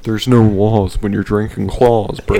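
bro voice. Retold or imitated. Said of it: "you sound like a bro Owen Wilson".

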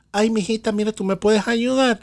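high, sing-song Maracaibo accent imitating mom. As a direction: put on mom's Maracaibo lilt. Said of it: "hace el acento maracucho de tu mamá".

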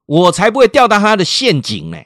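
high, loud mock indignation; defiant retort. Mother answers the stranger who stepped in, defiant. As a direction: act out an indignant mother refusing outright.

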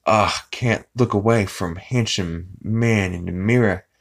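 lightly put-on self-smitten voice; mock vain admirer; narcissist. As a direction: imitate someone entranced by his own reflection. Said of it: "totally one hundred percent narcissist".

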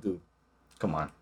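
dropped low and soft, coaxing; changed, appealing tone. Retold or imitated. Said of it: "change your tone".

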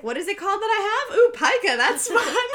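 delighted by a silly new word; giddy delight. Retold or imitated. Ashley thrilled to learn she has pica, giddy.

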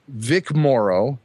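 ordinary talk, nothing marked

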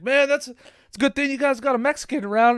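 loud, high, proud big-guy voice; Lopez bragging. Retold or imitated. Lopez standing up after the repair, loud and proud. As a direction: boast it like a proud friend. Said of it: "he's like".

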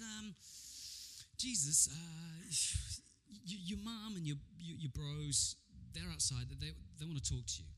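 halting, stammered delivery with long pauses; a timid interruption full of ums and restarts; hesitant messenger. Acted. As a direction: break the news awkwardly, tripping over the words. Messenger nervously interrupts Jesus.

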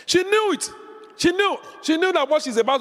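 high preacher's exclamation on "knew"; high emphatic cry. Real, not acted. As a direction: ring out "She knew" high and strong.

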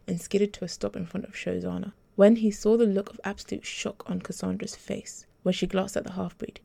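ordinary delivery, nothing marked

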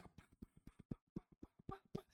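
rapid high pa-pa-pa gunfire; gunshot imitation. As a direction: mimic the shots popping off. (imitando balazos pa pa pa)

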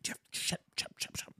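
urgent unvoiced hush; whispered shushing. Wife trying to shut her husband up, whispered. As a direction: imitate a wife hissing him quiet.

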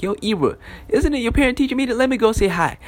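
high-pitched, upbeat dad voice; imitating a dad in high spirits; good-mood dad. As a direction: play a dad cheerfully inviting himself along. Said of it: "he was in a good mood".

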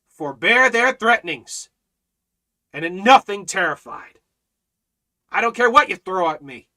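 loud, defiant pulpit voice; thundering defiance from the pulpit; fiery preaching. Acted. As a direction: preach it out with fire. Preaching at full force.

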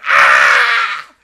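very loud, high mock scream; imitated scream. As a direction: howl like a man waking in terror. Carter wakes up screaming.

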